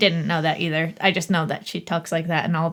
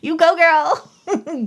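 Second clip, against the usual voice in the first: higher, slow hyped-up cheer for her; drawn-out hammed-up you go girl; mock cheering; 8 semitones higher, much slower than usual. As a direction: cheer her on like a fan in the seats. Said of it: "It's like".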